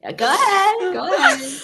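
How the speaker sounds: words bubbling with laughter; laughing along. Real, not acted. Teased host urging her cohost on while laughing. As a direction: let the laugh ride through it.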